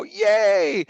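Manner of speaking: loud, high, drawn-out yay; mock cheer. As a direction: cheer with playful exaggeration. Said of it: "and I go".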